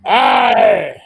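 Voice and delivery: loud pirate aye; pirate shout. Acted. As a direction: bellow it like a pirate.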